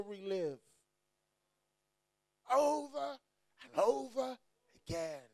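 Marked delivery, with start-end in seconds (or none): none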